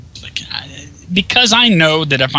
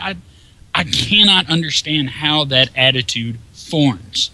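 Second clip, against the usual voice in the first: normal talking voice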